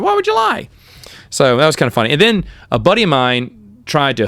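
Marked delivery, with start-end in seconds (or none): 0.0-0.7 rising exasperated why; real frustration, pitched up; frustrated. Real, not acted. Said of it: "I was just, like, frustrated".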